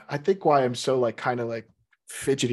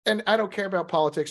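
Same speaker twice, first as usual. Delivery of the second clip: usual speaking voice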